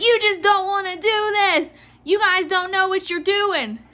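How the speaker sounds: high-pitched mock outrage; imitated screaming customer. Clerk mimics customer berating the manager, outraged. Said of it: "Mainly her just screaming".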